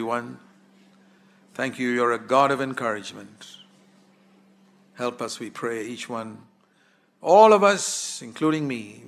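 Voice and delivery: closing congregational prayer. Acted. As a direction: pray humbly for the room.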